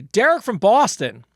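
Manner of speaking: a hint of a Boston accent, slow and loud on Derek from Boston; reading a listener name in a half-hearted Boston accent; bad Boston accent. Host announces the listener from Boston in a weak, New York-sounding Boston accent. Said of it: "That was New York".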